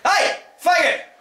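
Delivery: very high, slow, loud shout; yell across street. Shown as a demonstration. Teacher demonstrating a high yell across the street. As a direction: call out to someone across a busy street. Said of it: "imagine you're standing this side of the street".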